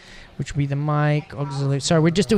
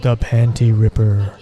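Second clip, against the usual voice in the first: lower, slow, hammed-up drawn-out name; a mock accented Panty Ripper, lower and slower; 6 semitones lower, 4 dB louder, slower than usual. Shown as a demonstration. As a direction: say it like a grand announcer with a put-on accent. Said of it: "do you have to do that with an accent?".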